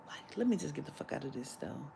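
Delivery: soft, fast mutter fading to a whisper; muttered restraint. Real, not acted. Comedian biting back anger at the clerk, muttered soft. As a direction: hold the anger down under breath. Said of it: "I'ma snap".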